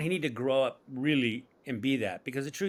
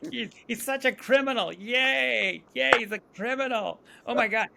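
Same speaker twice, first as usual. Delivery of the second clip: much higher, louder gushing mock voice; mocking casting agents; 11 semitones higher, 4 dB louder than usual. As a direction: squeal with delight like a thrilled casting agent. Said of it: "And they're like".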